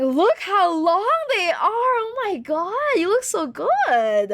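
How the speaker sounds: showing mom her photo, gushing; hype man. Retold or imitated. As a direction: gush over the photo. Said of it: "I'm like".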